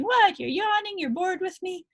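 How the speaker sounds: high, incredulous owner voice; mock puzzled owner. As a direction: play the dog owner who thinks the yawn means boredom. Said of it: "I'll hear people saying".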